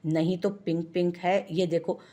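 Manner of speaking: plain reading voice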